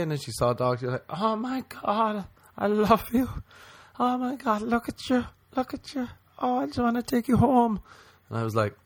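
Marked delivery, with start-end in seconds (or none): 1.1-7.8 put-on high baby-talk gushing; gushing dog lady. Imitating a woman cooing at a dog, high gushing.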